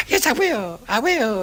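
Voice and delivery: mock eager young scout. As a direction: squeak out a thrilled yes. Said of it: "he said".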